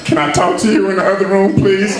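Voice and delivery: high, pleading fake sob; mock crying plea. A husband begs his cheating wife to talk privately, mock-crying. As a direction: beg to talk in private, whimpering. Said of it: "I was crying out".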